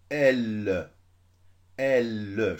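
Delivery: "L" pressed loud with a long held l sound; leaning on L. Shown as a demonstration. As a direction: lean hard on "L", stretching the consonant. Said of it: "J'appuie sur le L". (« L » très appuyé, consonne prolongée)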